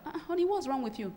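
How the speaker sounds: offhand careless question. Shown as a demonstration. Husband on the phone brushing off his wife, careless. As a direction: ask without really caring.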